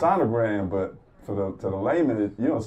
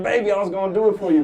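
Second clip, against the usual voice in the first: high, fast, loud flirty line; hammed-up flirty pitch; mock smooth-talker; 11 semitones higher, 8 dB louder, faster than usual. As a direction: sell it like a charming player. Flirty retort to nurse.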